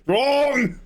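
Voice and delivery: high, drawn-out Arnold-style outburst; Arnold impression. Streamer looping an Arnold line, mimicked high cry. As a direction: cry the word out long like Arnold. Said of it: "[name]'s entered his Arnold loop".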